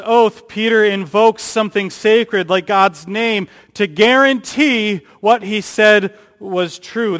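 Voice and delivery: preaching at full force; forceful preaching. Acted. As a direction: drive the point home from the pulpit. Preacher building intensity.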